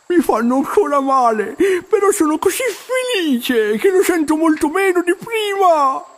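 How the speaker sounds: high, happy call across the water; called back. A narrator's reading. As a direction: shout back joyfully to the salmon. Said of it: "gridò Arturo di rimando". (Arturo risponde gridando al salmone, acuto e felice)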